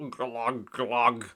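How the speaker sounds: gulping water, glug glug; a drowning glug; glugging. A narrator's reading. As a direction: glug as if sinking. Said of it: "glugged Mister Grinling".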